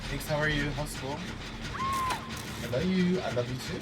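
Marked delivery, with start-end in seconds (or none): 1.8-3.8 slow, sweet and drawn-out; tender drawn-out I love you; affectionate. Real, not acted. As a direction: say it fondly, stretching the words.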